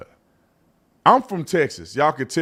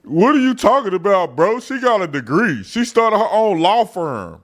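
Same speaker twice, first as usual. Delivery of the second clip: higher, mock-indignant critic voice; 5 semitones higher than usual. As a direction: parody the outraged fan defending her. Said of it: "somebody messaged me and go".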